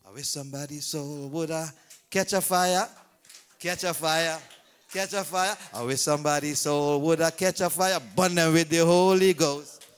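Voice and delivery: low, loud holy cry; fiery preaching. Acted. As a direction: call down the fire on them. Preaching at full force.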